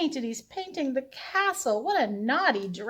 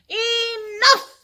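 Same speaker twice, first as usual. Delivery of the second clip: much higher and louder Enough; villagers' shout; 12 semitones higher, 8 dB louder than usual. Acted. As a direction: cry it out like an exasperated crowd. Villagers fed up in story.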